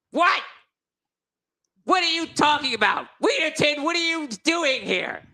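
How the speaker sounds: the king confronts Wheaterton, incredulous; astonished king. Acted. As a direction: demand an explanation in disbelief.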